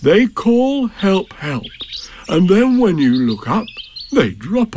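unhurried, interested bear; Pooh's voice. Acted. Pooh calmly explains the call.

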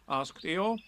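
usual speaking voice

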